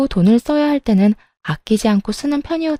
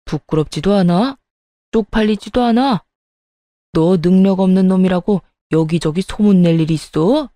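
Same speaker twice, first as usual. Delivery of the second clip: angry outburst. A narrator's reading. Father-in-law berates his son over borrowing money, angry scolding. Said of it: "시부가 화를 버럭 냈어요".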